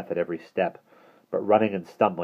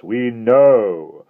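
slow, louder, mildly insidious menace; 7 dB louder, much slower than usual. Acted. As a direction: coax them with a creeping threat, drawn out. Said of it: "gentle, insidious".

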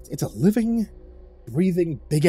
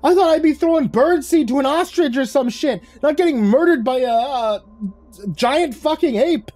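much higher, louder hysterical rant; panicked, outraged screeching tirade; 13 semitones higher, 4 dB louder than usual. A narrator's reading. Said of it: "I flailed my arms and screeched".

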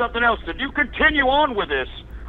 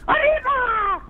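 mock arriba cry; 12 semitones higher, slower than usual. Mocking the caller who hung up.

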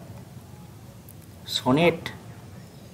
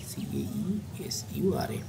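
murmured spelling; 13 dB quieter than usual. Real, not acted. Teacher spelling a term for students, murmured very softly. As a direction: spell it out under your breath.

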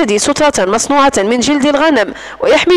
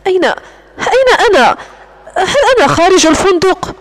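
halting, frightened stammer, rising a little higher; Alice stammering to her captor, lightly shaken; 4 semitones higher than usual. Acted. الفتاة تسأل الرجل متلعثمة بخوف خفيف. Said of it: "ثم قالت متلعثمة".

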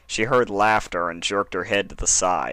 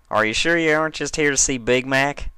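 higher, sly teasing question; Applejack teasing; 7 semitones higher, 4 dB louder than usual. Acted. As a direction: needle her about her crush.

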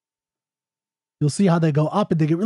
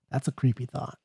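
much softer, spooky "creepy thought"; hushed eerie aside; 4 semitones lower, 9 dB quieter than usual. Acted. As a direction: drop to a hush.

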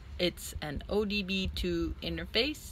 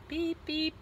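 high beep beep imitation; imitated beeping; 12 semitones higher than usual. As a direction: copy the reverse beep sound. Mimicking the reverse beep.

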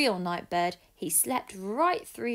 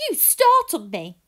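loud, still shaken; man startled by an owl, crying out; 4 semitones higher, 11 dB louder than usual. Acted. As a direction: blurt it out after a fright. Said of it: "cried the man".

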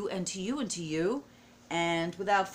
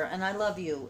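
usual speaking voice

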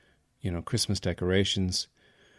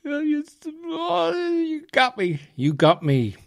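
1 mock overwhelmed wail; slower than usual. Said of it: "and I was like".

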